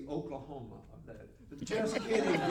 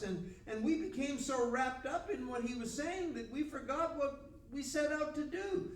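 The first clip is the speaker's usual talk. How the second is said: higher-pitched, hesitant officer explaining himself; flustered excuse; 8 semitones higher than usual. Acted. Returning officer explains to priests why no arrest, flustered. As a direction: make excuses in a raised, acted voice. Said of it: "We can almost hear the response".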